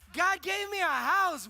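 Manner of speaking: loud, high, drawn-out declaration; jubilant insistence. Acted. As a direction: proclaim it slowly and proudly. Imagined insistent reply to Wes.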